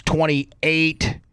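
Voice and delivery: usual speaking voice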